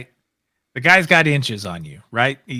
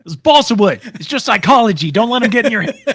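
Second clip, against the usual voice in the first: high, raised voice with a laugh creeping in; urgent cornerman; 8 semitones higher, 5 dB louder than usual. Acted. Cornerman reassuring his fighter about psychology, high urgent shout. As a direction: call it out over the crowd to your fighter.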